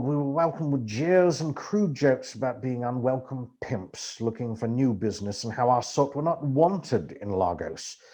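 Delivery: plain reading voice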